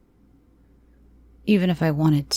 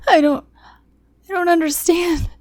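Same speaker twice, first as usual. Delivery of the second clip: much higher, broken sobbing plea; begging through tears, voice cracking; pleading behind sobs. Acted. Terrified girl sobbing her plea to the captor. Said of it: "I pleaded behind sobs".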